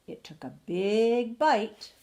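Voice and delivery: "big" drawn out long and loud; slow build onto a booming "big"; stress on BIG. A narrator's reading. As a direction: stretch and swell the word "big". Climax of the suspense.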